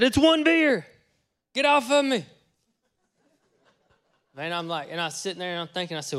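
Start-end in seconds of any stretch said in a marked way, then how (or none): 0.0-2.2 high, loud retelling of a drinker's protest; loud high defensive protest; defensive retort. Retold or imitated. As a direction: holler it back defensively, pitched up. Retelling himself snapping back about beer.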